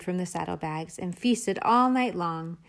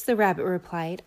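ordinary delivery

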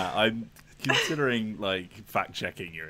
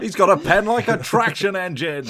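commentator impression; 9 semitones higher, 8 dB louder than usual. Continues Partridge football quote.

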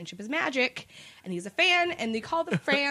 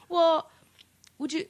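hesitant persistence; 4 semitones higher than usual. Retold or imitated. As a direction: imitate a questioner timidly pressing on. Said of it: "this guy just keeps going".

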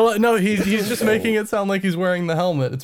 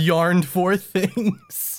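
slow yarned; much slower than usual. Acted. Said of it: "Please say yarn for".

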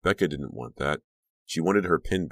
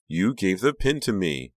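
cheery insistence; 5 semitones higher, 4 dB louder than usual. A narrator's reading. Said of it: "Honey insisted cheerily".

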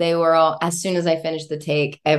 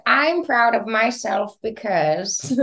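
slow, higher, put-on proud voice; playing up her pride as a joke; mock self-praise; 6 semitones higher, slower than usual. Imagined self-praise, mock answer.

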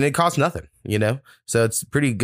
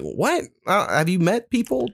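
mock disbelief; 6 semitones higher than usual. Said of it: "And you're like".

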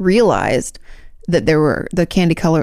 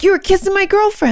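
high-pitched hammed-up accusation; squealed mock indignation; mock jealous outrage. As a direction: play an outraged partner, much higher. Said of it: "you're like".

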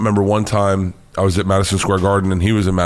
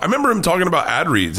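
ordinary talk, nothing marked

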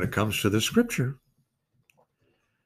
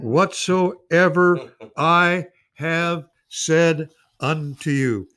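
"whatsoever" drawn out very slow and higher; emphasized slowly; 4 semitones higher, much slower than usual. Shown as a demonstration. Preacher finishing the verse for his church, slow and emphatic. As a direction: lean hard and slow on "whatsoever I have said". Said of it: "just emphasize that word".

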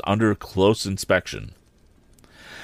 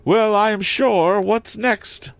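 much higher, louder contempt; contemptuous lady; 13 semitones higher, 3 dB louder, slower than usual. Acted. Sarah Pocket scorning Pip as he leaves, higher and louder. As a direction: sniff it out with disdain.

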